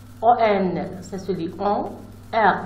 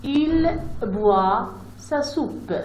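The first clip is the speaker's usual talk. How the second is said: Il boit sa soupe read very slowly, word by word; slow sentence reading; 4 semitones higher, much slower than usual. Shown as a demonstration. Teacher reading the sample sentence slowly to pupils. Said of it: "je peux lire aussi une phrase".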